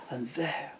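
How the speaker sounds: ordinary delivery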